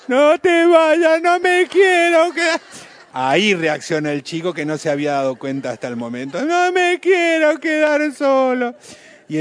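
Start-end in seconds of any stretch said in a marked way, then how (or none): 0.1-2.6 put-on very high, loud, slow wail of a kid; begging child. Child voice begs leaving mom not to go. 6.4-8.7 put-on very high, loud, slow wail of a kid; begging child. Child voice begs leaving mom not to go.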